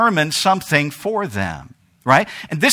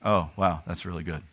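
muted, softer mock reaction; flat underwhelmed; 13 semitones lower, 13 dB quieter than usual. Imagined heir reacting to the will, muted and unimpressed. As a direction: mumble it like it barely matters. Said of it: "How many of you would be like".

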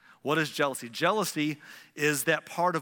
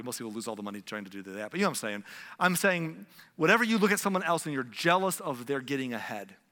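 usual speaking voice

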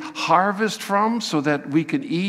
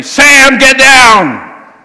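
very loud, high alarmed yell; urgent shout; 10 semitones higher, 17 dB louder, much slower than usual. Pretend. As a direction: yell up at a grandson on the roof. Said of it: "you have used the vocative voice".

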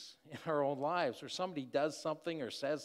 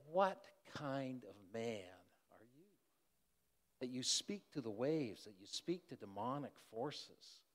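awed questioning; 6 dB quieter than usual. Acted. Disciples in awe questioning Jesus.

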